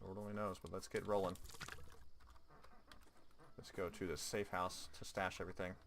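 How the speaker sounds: ordinary delivery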